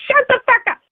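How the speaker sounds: acting out the yell in your head, pitch jumping up; high mock yell without real force; mock inner scream. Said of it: "I'm screaming it".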